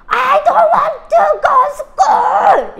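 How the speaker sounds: put-on high, very loud, dragged-out child wail, half laughing; whiny child wail. Mom imitating her daughter's loud morning wail. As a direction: wail it like a stubborn little girl refusing school. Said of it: "이래요".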